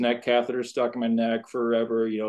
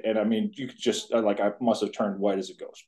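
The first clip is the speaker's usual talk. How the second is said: usual speaking voice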